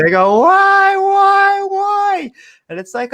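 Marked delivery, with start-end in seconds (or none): 0.4-2.3 much higher, loud anguished wail; screaming why. Retold or imitated. Dying Egyptian sons cry out high and loud.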